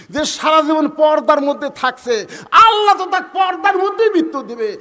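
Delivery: high, loud astonished questioning; incredulous outburst. Acted. Husband disputing his wife's prayer, rising incredulous shout. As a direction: build to a shout of disbelief.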